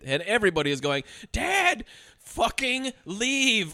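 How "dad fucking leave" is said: high, long-drawn urging; mock exasperated plea. Family begging dad to leave, mock exasperated plea. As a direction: whine at him to go, dragging words out.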